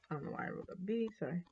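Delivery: under-the-breath whisper to self; whispered aside. Real, not acted. Muttered aside about own mistake.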